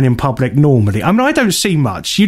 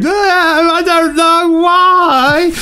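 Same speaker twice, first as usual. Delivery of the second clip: high, drawn-out sing-song wail; hammed-up drunken blubbing, stretched out; mock wailing; much slower than usual. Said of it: "I just kept going".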